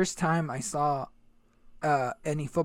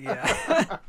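smug high yeah; 11 semitones higher, 3 dB louder than usual. Retold or imitated. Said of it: "I was like".

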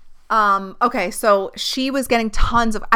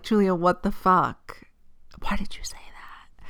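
hushed, stunned whisper; whispered disbelief; 8 dB quieter than usual. Retold or imitated. Asking Julia why she said that, stunned whisper. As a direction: whisper it, shaking your head.

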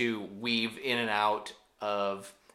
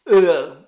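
loud, disgusted "ugh"; disgusted groan; 5 semitones higher, 12 dB louder than usual. Real, not acted. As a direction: groan loudly in revulsion. Groan at a gory injury.

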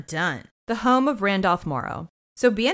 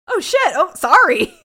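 flustered apology; 10 semitones higher, 9 dB louder than usual. Retold or imitated. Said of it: "She's like".

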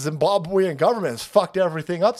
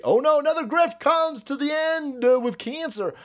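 high, hammed-up oh no; mock sarcastic whine; mock dismay; 10 semitones higher than usual. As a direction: fake alarm at the news. Mocking grifters.

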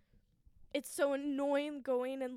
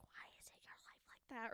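hushed whisper of a nagging inner voice; breathy whispered self-doubt; 12 dB quieter than usual. Retold or imitated. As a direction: whisper it like a doubt in your head. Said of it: "this little voice in the back of my head".

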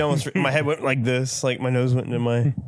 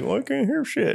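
high, confused friend voice; 11 semitones higher than usual. Retold or imitated. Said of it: "be like".

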